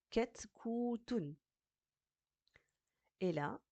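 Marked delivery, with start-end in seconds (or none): 0.1-1.3 "ku" drawn out, higher; short "Ket", long "ku", then "tun"; syllable-by-syllable reading. Shown as a demonstration. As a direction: sound out the word, stretching "ku". Teacher sounds out word by syllables.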